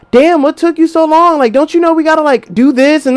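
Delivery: put-on high, complaining girlfriend voice; mock whiny nag at the door; lightly voiced impatient girlfriend; nagging girlfriend.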